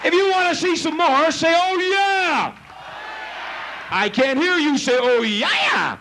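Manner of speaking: high, projected showman hype; rousing crowd call. Real, not acted. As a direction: whip the audience into shouting back. Rousing the crowd to shout back.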